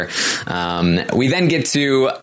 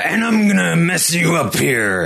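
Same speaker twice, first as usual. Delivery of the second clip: booming wrestler boasts; 6 semitones higher than usual. Retold or imitated. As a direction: bellow a heel's threat. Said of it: "like the wrestler".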